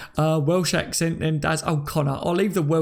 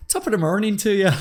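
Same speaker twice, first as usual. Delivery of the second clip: high, lilting mock-Irish; badly faked Irish lilt, breaking into laughter; Irish accent; 5 semitones higher than usual. Shown as a demonstration. Streamer doing his requested Irish impression, hammed-up Irish accent. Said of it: "what was my Irish impression?".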